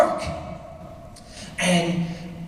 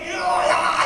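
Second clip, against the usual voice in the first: Arnold Schwarzenegger impression; 3 semitones higher than usual. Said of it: "sounded like Arnold Schwarzenegger".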